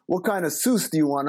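Baltimore "sauce" said like "Seuss"; Baltimore accent. Retold or imitated. Wendy's girl taking a nuggets order, imitated Baltimore accent. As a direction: imitate a Maryland counter girl's Baltimore accent. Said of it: "and the girl tell, asked me".

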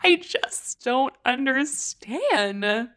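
slow, baffled disbelief; exasperated. Real, not acted. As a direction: drag it out, totally bewildered. Baffled by the collab.

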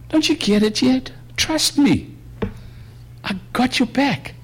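a lightly voiced Jesus urging Peter to trust; Jesus pleading with Peter.

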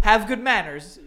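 mock proper lecture.

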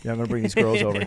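smoky Hassan voice. Retold or imitated. Streamer imitating Hassan inviting girls over, quick smoky mumble. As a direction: toss it off like a laid-back smoky guy. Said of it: "he's saying it like he talks like that".

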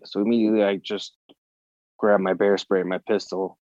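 normal talking voice

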